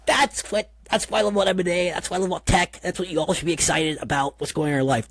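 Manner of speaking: gushing, carried away by the story; thrilled about tech. Retold or imitated. Telling the host his own reaction, retold excitement. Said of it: "And so I was just like".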